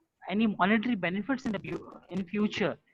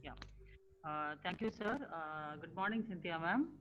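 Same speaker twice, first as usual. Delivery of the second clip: very soft, faint greeting; barely audible; 14 dB quieter than usual. Real, not acted. Attendee greeting the session's host, very softly. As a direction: speak far too quietly to be heard. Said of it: "ma'am, I don't hear you".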